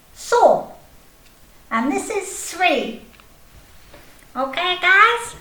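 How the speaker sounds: high, cheeky little snail voice counting; Snail puppet voice. Acted. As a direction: play a chirpy snail puppet showing off.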